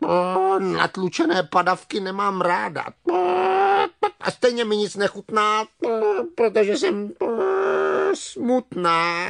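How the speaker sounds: clucking, complaining hen turning sorrowful; sighing hen. Acted. Hen admitting she is sad, sighing clucks. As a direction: cluck out the complaint, drooping into sadness.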